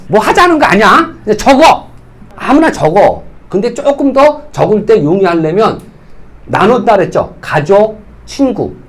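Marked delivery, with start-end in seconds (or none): 0.1-3.2 loud, insistent push to just write names; urging shout. Real, not acted. Trainer to trainees, loudly urging them to write names. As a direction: bark it at the room to just write.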